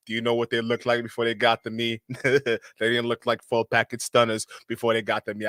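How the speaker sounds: impersonating the coach hosting a lesson; mocking dating-coach voice. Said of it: "I can totally picture that shit too".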